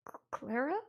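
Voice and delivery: stammered, questioning name; confused. Acted. Charles recognizing his wife, lightly voiced and confused.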